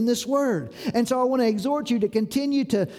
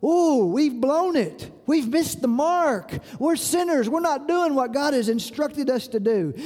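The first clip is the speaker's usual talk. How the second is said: higher-pitched voicing of sudden realization; dismayed realization; 5 semitones higher than usual. People hearing God's instructions realize their sin, in mild dismay. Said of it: "they realized".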